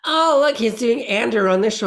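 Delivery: high-pitched mock exclamation; mock gushing remark. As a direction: imitate an excitable viewer spotting something. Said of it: "it's so funny when people say".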